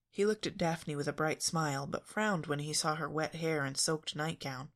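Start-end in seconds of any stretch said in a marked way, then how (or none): none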